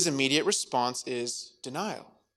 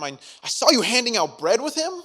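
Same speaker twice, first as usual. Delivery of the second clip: higher pressing accusation; 8 semitones higher, 5 dB louder than usual. Acted.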